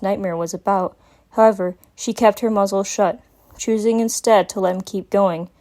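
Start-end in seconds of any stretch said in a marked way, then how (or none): none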